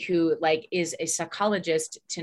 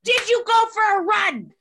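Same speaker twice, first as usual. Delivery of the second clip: high, loud, bossy checklist, laughing; wife fixing her husband, mock shrill and high; nagging fixer wife; 12 semitones higher, 5 dB louder than usual. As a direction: fuss over him like a shrill nurse. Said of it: "I'm gonna be like".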